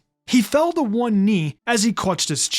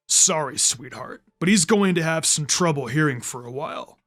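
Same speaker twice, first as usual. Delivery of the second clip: lower, smug sound ninja mocking the girl; a lower, gloating enemy voice; smug villain taunt; 6 semitones lower than usual. A narrator's reading. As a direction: talk down to her like a sneering villain.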